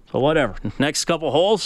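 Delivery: ordinary talk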